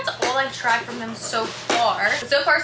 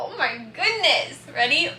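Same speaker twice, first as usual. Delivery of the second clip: normal talking voice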